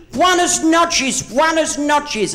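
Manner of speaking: high, slow "Buenas noches"; sing-song Spanish greeting. Retold or imitated. Locals greeting the visitor, high lilting Spanish.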